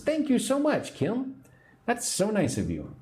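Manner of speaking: delighted high thanks to a viewer; gushing thanks. Real, not acted. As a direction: thrill at the gift, voice leaping up.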